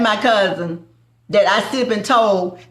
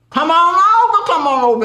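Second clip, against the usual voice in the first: much higher, louder come on over; inviting call; 9 semitones higher, 4 dB louder than usual. Real, not acted. Calling the family over to taste, high and loud. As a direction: beckon them in warmly.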